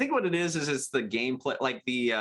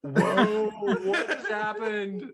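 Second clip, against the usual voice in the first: higher, louder, slow amazed whoa through laughter; laughing astonishment; 8 semitones higher, 3 dB louder, much slower than usual. Retold or imitated. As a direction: marvel at the absurd moment, laughing. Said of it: "and I'm like".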